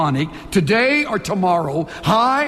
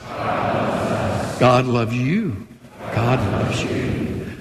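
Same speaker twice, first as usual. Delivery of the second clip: slow, hushed "God loves you", lower; tender blessing; 7 semitones lower, much slower than usual. Real, not acted. Pastor gently assuring each listener, tender.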